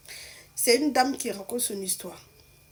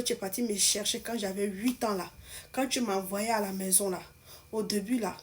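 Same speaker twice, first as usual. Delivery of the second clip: ordinary talk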